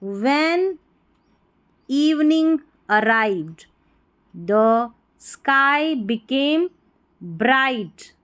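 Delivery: very slow, deliberate dictation with rising phrases; word-by-word reading. Shown as a demonstration. Teacher reading silent-letter story to learners, slow word by word. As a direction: read each word separately, stretched out.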